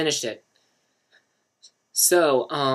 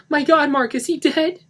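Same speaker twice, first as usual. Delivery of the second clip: high, loud panic; near-shrieking dread; panicked; 12 dB louder than usual. Acted. Lisa begging Mark for an answer, panicked. Panicked woman over a body.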